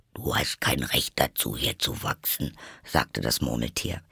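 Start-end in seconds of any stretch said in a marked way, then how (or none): 0.1-2.5 low, subdued creature grumble; deep, muted Dormouse voice; grumpy Dormouse. Acted. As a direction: object in a low, soft animal voice. Narrator plays Dormouse.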